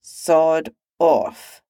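talking through a mouthful; mouth full. Acted. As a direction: grumble with food in mouth. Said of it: "said through a mouthful of pheasant".

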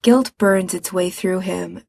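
plain reading voice

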